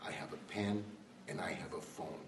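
soft, understated quote of a president; Obama. Retold or imitated. President defying Congress in a speech, quietly quoted. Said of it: "And he said".

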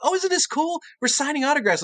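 high-pitched imitation of thrilled colleagues; mock excitement. Colleagues delighted at first signing.

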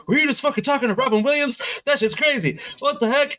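high, quick, amazed outburst; astonished cousin. Retold or imitated. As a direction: imitate a starstruck cousin who can't believe it. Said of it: "I was talking to my cousin".

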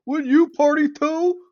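high, drawn-out shouted question; incredulous yell. Real, not acted. Heated teasing argument with co-host.

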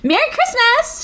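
high chirpy greeting, lightly voiced; a hint of sing-song cheer; lightly mock chirpy. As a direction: say it like a clueless cheerful friend. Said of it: "she says".

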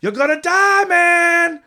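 high, loud drawn-out holler; imitated yell. Retelling the fighter yelling at his opponent, high hammed-up yell. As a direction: yell it out long and high, mimicking him. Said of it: "Just, like, yelling out".

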